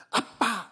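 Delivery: "아빠" punched high and loud in Gyeongsang dialect; sharp dialect call of 아빠; Gyeongsang accent. Shown as a demonstration. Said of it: "악센트를 잘 줘야 돼요".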